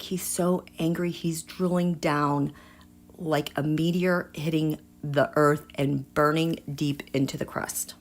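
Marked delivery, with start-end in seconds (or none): none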